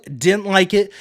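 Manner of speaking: normal talking voice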